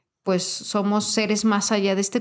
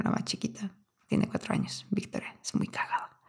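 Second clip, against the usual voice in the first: whispered aside; 11 dB quieter than usual. Real, not acted. Affectionate aside about niece.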